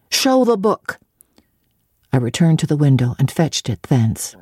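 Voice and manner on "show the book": put-on higher, bossy boy voice; a bratty command; demanding boy. A narrator's reading. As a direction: order her like a spoiled young master. John Reed orders her.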